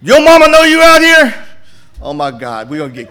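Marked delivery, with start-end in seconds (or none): 0.0-1.5 high, loud mock scolding shout; retold yell. J D yelling at a boy in the projects, loud and high. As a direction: holler it like a street elder. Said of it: "he yelling at people".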